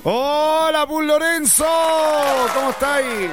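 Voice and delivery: high, sustained radio-host hello; exuberant greeting. Real, not acted. Host greeting caller Lorenzo on air, exuberant high welcome. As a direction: hail the caller with big energy.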